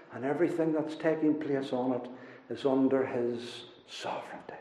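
drops to a soft, hushed voice; softly awed sermon aside; hushed awe. Real, not acted. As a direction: let it fall quiet in wonder. Reflective hush on God's sovereignty.

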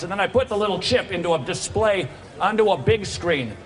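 loud, high street preaching; open-air preaching. Real, not acted. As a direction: preach to the crowd at full force.

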